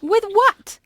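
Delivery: high, rising challenge; incredulous scoff. Acted. Shopkeeper to a penniless customer, incredulous. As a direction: throw it back in disbelief. Scoffing at his wish to buy.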